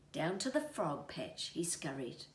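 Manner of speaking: usual speaking voice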